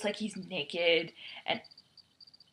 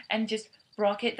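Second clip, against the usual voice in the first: ordinary talk, nothing marked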